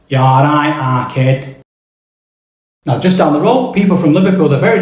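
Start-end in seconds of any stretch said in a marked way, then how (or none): 0.1-1.6 Mancunian accent. Shown as a demonstration. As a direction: say it like a Manchester lad. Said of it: "they will say hello by going".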